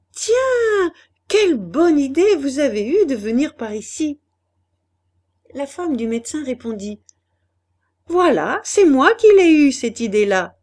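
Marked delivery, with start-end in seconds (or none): 0.1-4.2 loud, high cry of delighted surprise; exclaiming in surprise. A narrator's reading. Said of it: "Gontran s'écriait". 8.1-10.6 loud, high, crowing claim; proud exclamation. A narrator's reading. Doctor's wife proudly claims the idea.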